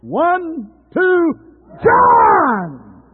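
high shouted count, huge drawn-out name; all-out shout. Shown as a demonstration. Preacher leading the congregation's name call, all-out shout. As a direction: bellow it to a whole crowd. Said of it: "Let's go again".

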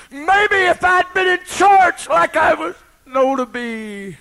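high, loud cry; sudden anguished shout. Real, not acted. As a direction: burst out in anguish. Sudden outburst in sermon.

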